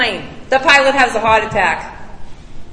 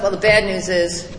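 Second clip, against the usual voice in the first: deadpan bad news; 7 semitones lower than usual. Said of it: "She said".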